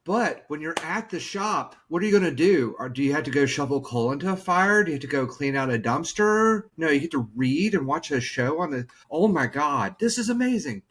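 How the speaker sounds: hammed-up awe at a car repair; mock amazement. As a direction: gush like it's the best news ever. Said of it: "catching on what I was doing".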